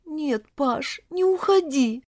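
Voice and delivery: high, pleading heroine's voice; plaintive plea. A narrator's reading. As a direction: beg him pitifully to stay, pitch climbing. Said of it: "жалобно протянула она к нему руки". (жалобная мольба высоким голосом)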